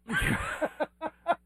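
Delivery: high breathy laugh. Real, not acted. Laughing at his own story.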